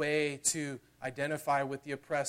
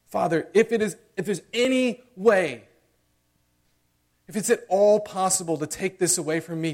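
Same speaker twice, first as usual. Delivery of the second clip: anguished plea; 6 semitones higher, 13 dB louder than usual. Retold or imitated. Jesus praying in the garden.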